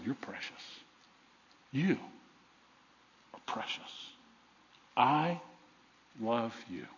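very soft, low, long pauses; near-whispered, word by word reassurance; tender Father. Acted. Voices the Father speaking to listeners.

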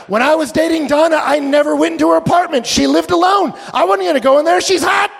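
very high, loud exclaiming; worked-up, high-pitched near shout; comic outburst. Real, not acted. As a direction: protest at full pitch for laughs. Comic outburst about dating wife.

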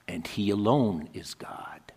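soft whispered close. Acted. God's quiet word, whispered to the listeners. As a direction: breathe it out reverently. Hushed ending of God's words.

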